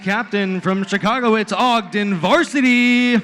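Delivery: loud, drawn-out hype announcer call; fighting game announcer. Acted. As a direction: stretch the team name like a fight intro. Hype team introductions.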